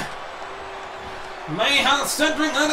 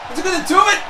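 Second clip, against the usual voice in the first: ordinary talk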